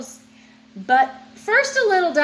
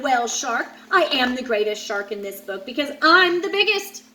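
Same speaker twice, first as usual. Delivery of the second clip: usual speaking voice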